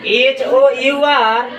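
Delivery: एच ओ यू आर, each letter slow and separate; teacher spelling एच ओ यू आर slowly for the class. Shown as a demonstration. Letter-by-letter slow dictation. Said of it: "बतलाइएगा".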